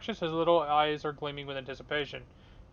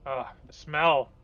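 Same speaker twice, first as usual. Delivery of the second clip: slow, loud groan of disgust; 7 dB louder, slower than usual. Real, not acted. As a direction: recoil at the stench. Disgust at slime stench.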